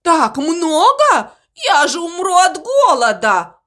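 loud, slow, alarmed wail; distressed outcry. Acted. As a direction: cry out in distress. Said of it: "распереживался Мопси". (расстроенно, громко)

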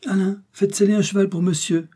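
high-pitched summons to a servant; acted hostess giving orders; calling an order. A narrator's reading.

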